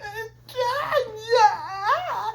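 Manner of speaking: very high, sobbing falsetto wail; mock screaming. Alice crawling around Brenda's body, high mock wailing. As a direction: imitate a horrified victim wailing. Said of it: "she screams".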